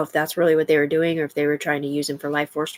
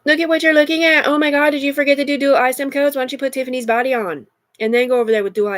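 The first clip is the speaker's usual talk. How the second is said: loud, high furious yelling; angry shout; 7 semitones higher, 4 dB louder than usual. Real, not acted. Woman yelling at the voices in her head, furious.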